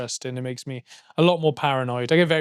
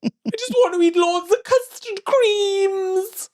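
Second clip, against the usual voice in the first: very high, slow, teary Welsh valleys wail; wobbly stoned whimper in a Welsh valleys accent; much slower than usual. Pretend. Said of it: "That's what I'd go like".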